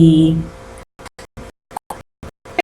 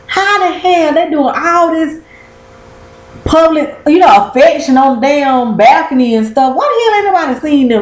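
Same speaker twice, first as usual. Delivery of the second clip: incredulous; 6 semitones higher than usual. Real, not acted. Incredulous rant about kissing publicly.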